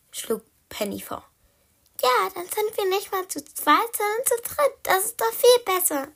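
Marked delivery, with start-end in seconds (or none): 2.0-6.2 high, gleeful voice with a small giggle; loud, high, giggly delight; giggling. Acted. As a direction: pipe up happily and end in a giggle. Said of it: "kicherte Blackie".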